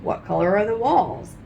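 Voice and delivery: ordinary delivery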